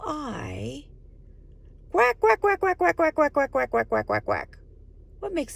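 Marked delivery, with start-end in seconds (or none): 1.9-4.6 duck quacking. Acted. Adult playing a guessing game with a child, duck quacks. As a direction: quack like a duck, tumbling down in pitch. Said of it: "duck make that sound?".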